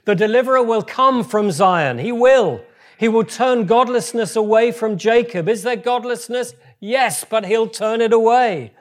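rally the congregation with every word; preaching at full force. Real, not acted. Preacher urging the church to declare it, loud and rising. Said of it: "Come on, just say it with me".

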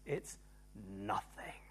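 slow whisper on the key word; soft, drawn-out whispered reveal; hushed emphasis. Real, not acted. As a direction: drop to a low whisper for "nothing". Preacher drops voice for key word.

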